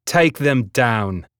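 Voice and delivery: slow, commanding rebel voice; gruff command. A narrator's reading. Vidius ordering the mutineers to attack, slow command. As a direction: order the charge, slowly.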